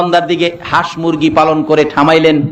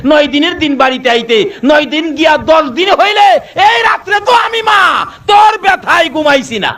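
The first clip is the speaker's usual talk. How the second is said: much higher, shouted reproach of a dead mother; mother's voice from the grave; 12 semitones higher than usual. Acted. Dead mother rebuking her neglectful son from the grave, shouting. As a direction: wail the rebuke at the careless son. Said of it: "কি বলে?".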